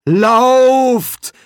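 loud, high, drawn-out cry; shouted warning. Acted. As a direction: yell to the others to flee. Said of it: "rief der Dekan".

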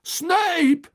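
very high, loud shout. A narrator's reading. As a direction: cry the name out. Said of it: "rief er".

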